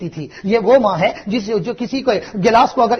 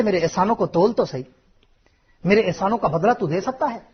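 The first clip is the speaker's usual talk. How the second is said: ordinary talk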